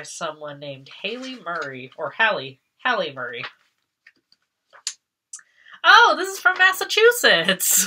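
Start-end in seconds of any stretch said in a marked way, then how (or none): 5.8-7.9 high, loud delighted exclamation; light up at seeing home; thrilled gasp of recognition; excited surprise. Real, not acted. Delighted discovery of home state.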